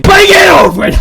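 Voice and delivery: sudden loud joking shout; mock yell. Gamer teases the anxious character with a mock yell. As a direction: bark it at the shy kid, all in jest. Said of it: "I'm joking".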